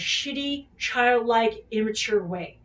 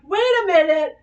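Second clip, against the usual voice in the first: higher and louder, startled inner voice; sudden realisation; 7 semitones higher, 6 dB louder than usual. Retold or imitated. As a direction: blurt it out as the penny drops.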